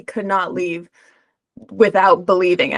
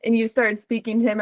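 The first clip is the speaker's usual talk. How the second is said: ordinary delivery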